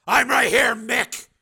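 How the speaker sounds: high, loud answering call. A narrator's reading. Steel answering Stalker from the dark, loud and high. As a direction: shout back from hiding. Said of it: "heard Steel call out".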